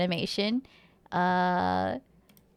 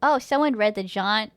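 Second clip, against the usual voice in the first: ordinary talk, nothing marked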